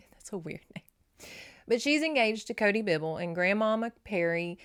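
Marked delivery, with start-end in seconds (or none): 0.1-1.3 softer, breathy joking aside; an unvoiced amused side comment; whispered aside. Real, not acted. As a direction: whisper it behind your hand. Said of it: "whispered aside after laugh".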